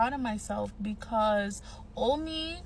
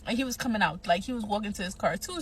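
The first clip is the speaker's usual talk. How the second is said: ordinary talk, nothing marked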